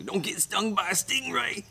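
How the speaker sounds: very high-pitched Aussie caricature; mock Australian accent. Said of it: "stop doing accents".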